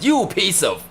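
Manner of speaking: high mock-angry dad, cut off mid-insult. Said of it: "he was like".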